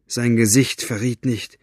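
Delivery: plain reading voice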